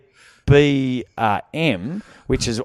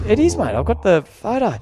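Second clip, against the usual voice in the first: high-pitched, protesting insistence; retold insistence; 9 semitones higher than usual. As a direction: plead your case to the disbelieving attendant. Retelling his insistence to the man.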